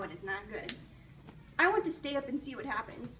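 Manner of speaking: ordinary delivery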